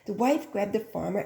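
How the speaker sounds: usual speaking voice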